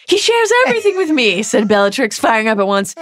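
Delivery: high, loud, fast gushing boast; hammed-up Bellatrix. Bellatrix boasting loudly to Snape, high hammed-up squeal. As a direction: squeal it like a smug fangirl.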